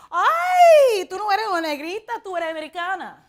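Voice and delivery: high, loud, fast mocking shop clerk; scornful clerk. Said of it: "I went".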